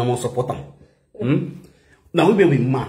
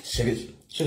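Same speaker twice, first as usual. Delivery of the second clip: usual speaking voice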